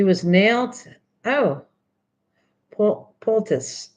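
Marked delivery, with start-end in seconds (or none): 2.7-3.9 "pol-, poultice" sounded out slowly, syllable by syllable; careful try at poultice. Shown as a demonstration. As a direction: feel your way through "poultice" slowly. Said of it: "You say poultice".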